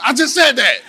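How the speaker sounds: high, indignant comeback, mock-serious; serious insistence. Preacher acting out corrected person, mock insistent. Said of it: "be serious about it".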